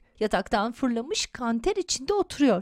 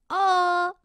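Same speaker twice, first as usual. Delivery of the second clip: high acted scream of fright; frightened cry; 8 semitones higher, 4 dB louder than usual. A narrator's reading. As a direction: cry out as if under attack. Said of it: "Taha ağlayıp bağırarak".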